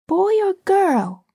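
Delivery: put-on high, slow little boy, doubtful; small boy's wary question; not too hopefully. A narrator's reading. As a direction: ask with little hope. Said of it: "asked Michael not too hopefully".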